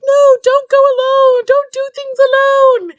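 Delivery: high, slow pleading warning from friends; mock warning. Friends begging Jane not to go alone, high and slow. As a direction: stretch it out like worried friends begging.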